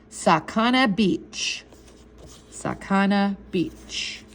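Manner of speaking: very slow, measured pronunciation; slow and deliberate. Acted. Older boy teaching a kid the magic word, slow and deliberate. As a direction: set out "Sakana Beach" word by word, slowly. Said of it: "pronounce the words very slowly and deliberately".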